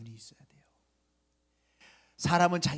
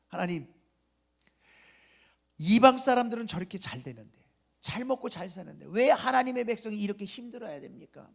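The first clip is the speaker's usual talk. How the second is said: raised, louder complaint to God; complaining prophet; 4 semitones higher, 6 dB louder than usual. Retold or imitated. Habakkuk complaining loudly to God, voicing his grievance. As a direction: plead and protest against God's silence. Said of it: "하나님 앞에 막 원망해요".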